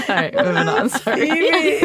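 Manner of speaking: laughing through the words, pitch jumping up. Real, not acted. Moving on after the snake sniper joke, laughing. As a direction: apologise while cracking up.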